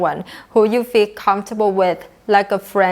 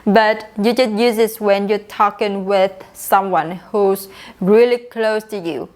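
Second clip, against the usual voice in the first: ordinary delivery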